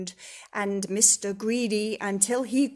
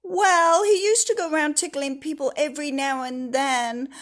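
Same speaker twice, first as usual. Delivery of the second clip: raised, loud complaint, slowing at the end; sighing Mister Happy; 5 semitones higher, 8 dB louder than usual. Acted. Mister Happy explains the tickling, raised and weary. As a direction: plead the trouble, dragging it out. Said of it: "he sighed".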